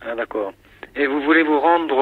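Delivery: usual speaking voice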